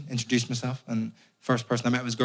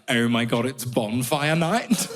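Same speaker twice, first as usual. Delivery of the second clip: put-on gushing English accent, last words stretched; mock English accent; 2 semitones lower, slower than usual. English people announcing a tradition to an Irishman, gushing English accent. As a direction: imitate an English enthusiast, drawing out the ending. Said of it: "all these mad English traditions".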